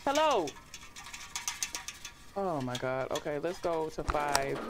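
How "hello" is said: high, loud startled call. Real, not acted. Player calling to whoever made the sound, high loud call. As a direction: call into the dark, pitched way up. Calls out to an unseen presence in game.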